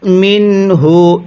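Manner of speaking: মিনহু with the n sounded clearly before হু; clear nun sakin. Shown as a demonstration. Teacher shows izhar on মিনহু, slow and clear. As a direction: say the n of মিনহু plainly, no nasal blend. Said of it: "স্পষ্ট করে পড়তে হবে".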